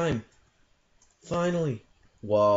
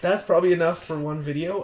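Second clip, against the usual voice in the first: bright, loud sign-off; loud outro; 5 semitones higher, 7 dB louder than usual. Real, not acted. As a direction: wrap up the video loudly. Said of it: "outro to viewers".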